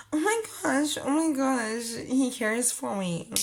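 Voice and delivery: high-pitched, giddy gushing; mock-swooning over a text; giddy excitement. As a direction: squeal it like a smitten teen. Gushing naive self, retold.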